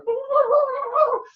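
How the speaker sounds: high squeaky Jawa gibberish burst; terrible Jawa gibberish. Retold or imitated. Vlogger mocking the Jawas' gibberish, high squeaky squeal. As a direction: squeal nonsense like a tiny scavenger. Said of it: "that was a terrible impersonation".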